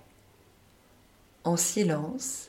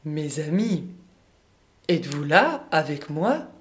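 louder voice; 4 dB louder than usual. Acted. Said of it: "La voix de Om se fait encore plus forte".

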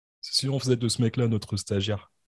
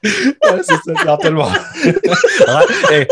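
laughing; 8 semitones higher, 13 dB louder than usual. Real, not acted. Laughing while saying it.

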